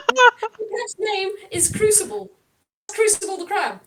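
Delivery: usual speaking voice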